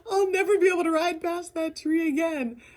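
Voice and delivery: retold crying friend. Friend at the ranch, retold as crying over the tree. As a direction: let the friend's voice crack into tears. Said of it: "and she just starts crying".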